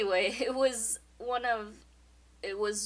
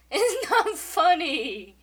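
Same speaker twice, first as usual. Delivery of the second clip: high, slow whiny protest; mock whine; 7 semitones higher, 9 dB louder, slower than usual. Real, not acted.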